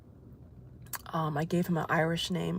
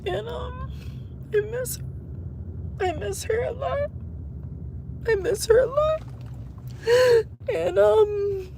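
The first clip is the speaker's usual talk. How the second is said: much higher, thin crying voice; choked sobs around each word. Real, not acted. Owner missing her dog, crying.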